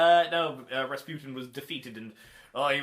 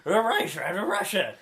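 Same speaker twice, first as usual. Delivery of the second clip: a touch higher and louder, hammed up; playful cartoon narration voice; 5 semitones higher, 5 dB louder than usual. Co-host adding to the Scooby photo album, in a playful cartoon voice. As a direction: join the bit in a mock cartoon voice.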